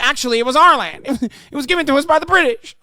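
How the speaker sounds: high, fast mock whine through laughter; hammed-up crybaby voice; mock whining. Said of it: "he's literally crying".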